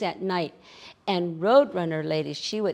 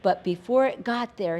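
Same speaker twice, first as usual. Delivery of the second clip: ordinary delivery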